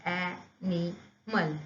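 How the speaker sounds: "एनिमल" split as a-ni-mal; "एनिमल" slowly broken into three beats; syllable by syllable. Shown as a demonstration. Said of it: "हम जब इसका pronunciation करते हैं".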